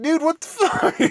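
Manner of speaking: high, loud, laughing disbelief; a laughing yelp of outrage; incredulous outburst. Retold or imitated. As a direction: squeal it out in shocked disbelief. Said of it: "I'm like".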